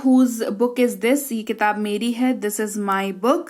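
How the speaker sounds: very slow, words pulled apart; slow model dialogue. Shown as a demonstration. Teacher slowly models question and answer for learners. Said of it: "ये किताब किसकी है?".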